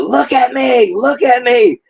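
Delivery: high-pitched imitation of a tantrum; mock temper tantrum. Prosecutor demanding the defendant look at her, mock tantrum. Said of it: "this temper tantrum and showing".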